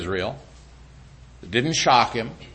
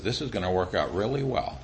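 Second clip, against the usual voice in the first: soft, lower, amused reassurance; chuckling God; 7 semitones lower, 9 dB quieter than usual. Acted. God calmly amused at the devil's move, chuckling. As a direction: say it with a quiet laugh in the voice.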